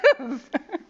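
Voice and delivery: short high giggle; little burst of giggles; amused giggle. Real, not acted. Laughs after own remark.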